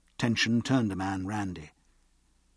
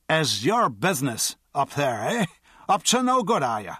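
higher, louder accusing tipsy voice; suspicious drunk; 5 semitones higher, 4 dB louder than usual. A narrator's reading. Drunk accuses the guard of mischief, tipsy and suspicious. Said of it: "said the drunk, standing stiffer".

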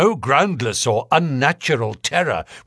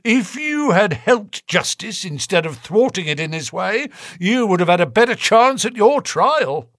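high, stern lecturing voice; cold probing inspector; 9 semitones higher, 3 dB louder than usual. A narrator's reading. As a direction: warn him like a detective.

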